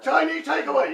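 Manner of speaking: loud, high-pitched hammed-up Cantonese accent; mock Cantonese accent. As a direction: call it out in a pretend Cantonese accent. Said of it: "in your best Cantonese accent".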